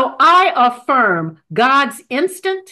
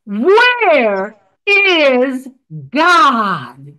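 a big man's stern, louder question; booming stern clergyman; 5 dB louder, much slower than usual. Acted. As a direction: loom over the boy and demand it sternly. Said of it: "huge man with a booming voice".

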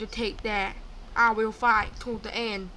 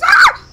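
short high, loud shriek; startled scream; 22 dB louder than usual. Real, not acted. Player jumped by a killer, startled scream. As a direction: scream in sudden fright.

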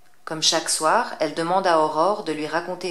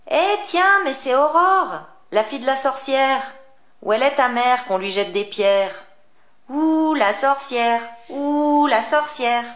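much higher, loud schoolyard taunting; jeering children; 8 semitones higher, 7 dB louder than usual. Acted. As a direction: kids mocking the witch's daughter. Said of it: "en s'écriant".

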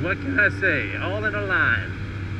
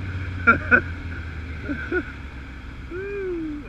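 hard laughter; 10 semitones higher than usual. Real, not acted.

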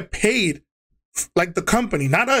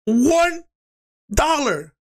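angry shout; 8 semitones higher, much slower than usual. Retold or imitated. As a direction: hammer out how small the amount is. Angry rant at district manager.

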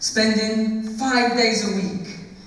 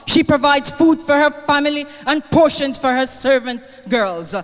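louder, rising preacher's reading of scripture; preaching; 3 semitones higher, 4 dB louder than usual. Acted. Eulogist honours Mother Dunstan with Proverbs, preaching. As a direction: proclaim the verses like a sermon.

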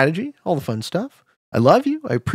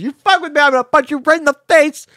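higher, tough-guy threat voice; mock threat; 10 semitones higher, faster than usual. Streamer voicing a rival's threatening message, mock tough guy. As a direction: play the bully, pitched up.

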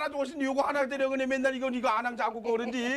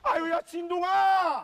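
long, slow, drawn-out call down the street; 3 semitones higher, 4 dB louder, much slower than usual. Acted. Owner calls for the missing Jindong, long and loud. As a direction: stretch the name out and send it far.

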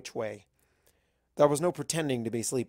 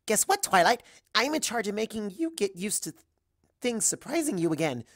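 much higher and louder, bubbly; Pinkie excited; 9 semitones higher, 4 dB louder than usual. Acted. Pinkie explaining her surprise job to Twilight, high and bubbly. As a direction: bounce through it with glee.

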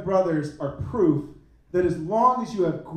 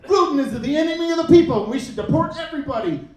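big rally voice, higher and louder; Trump impression; 6 semitones higher, 5 dB louder, faster than usual. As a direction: bellow it like a rally speech. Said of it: "Trump just woke up tomorrow and was like".

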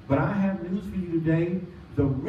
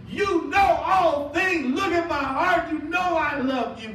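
preaching shout; 11 semitones higher, slower than usual. Acted. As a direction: shout it from the pulpit, drawn out.

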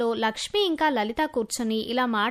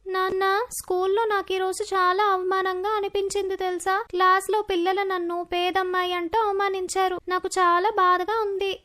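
put-on higher-pitched girl voice, mildly pleading; small, raised daughter voice; upset young daughter; 4 semitones higher than usual. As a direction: plead with dad like a hurt schoolgirl. Hurt daughter confides in father.

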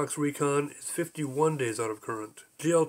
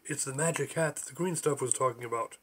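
usual speaking voice

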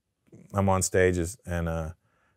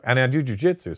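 ordinary talk, nothing marked

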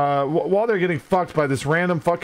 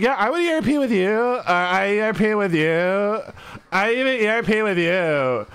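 mimicked eager reply; 4 semitones higher than usual. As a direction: parody someone all too keen to agree, stretching the words. Mimicking how people proposition each other.

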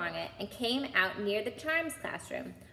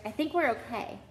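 plain reading voice

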